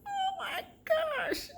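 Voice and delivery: very high, drawn-out wail; acted terror. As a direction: wail it slowly as if terrified. Retells terror at death threat.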